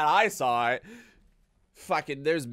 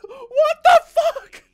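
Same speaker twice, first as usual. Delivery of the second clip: laughing shout; 13 dB louder, much slower than usual. Real, not acted. Shocked outburst with laugh.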